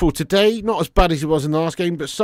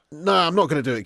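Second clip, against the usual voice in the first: ordinary talk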